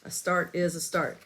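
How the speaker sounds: ordinary talk, nothing marked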